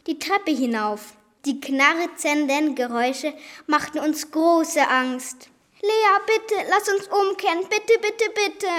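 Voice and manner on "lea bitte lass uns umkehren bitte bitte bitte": high, pleading little voice; helpless whimpering. Acted. Girl begging Lea to turn back on the stairs, high and pleading. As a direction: beg her friend to turn back. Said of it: "jammerte ich hilflos".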